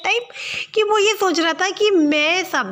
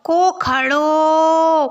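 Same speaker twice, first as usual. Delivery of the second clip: calling out; 4 dB louder, much slower than usual. Acted. Calling third daughter.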